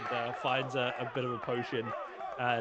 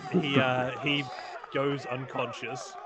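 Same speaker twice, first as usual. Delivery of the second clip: usual speaking voice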